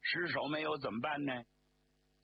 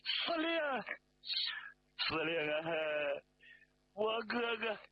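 tearful wailing; 8 semitones higher than usual. Acted. Officer reporting his brother's death to the commander, wailing. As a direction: wail each phrase in hammed-up grief, pitched high. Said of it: "向子杰哭着进来".

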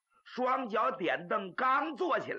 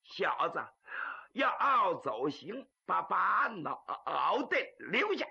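stammering shout. Acted. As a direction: call after a fleeing foe, tripping over syllables. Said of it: "在后边喊了一声".

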